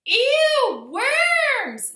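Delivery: high, loud disgusted shriek. A narrator's reading. Tiffany shrieking at the worms in the box, disgusted shriek. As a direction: squeal it out in disgust. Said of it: "Tiffany shrieked".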